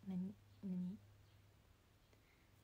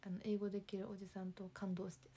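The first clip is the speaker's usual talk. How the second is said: ordinary delivery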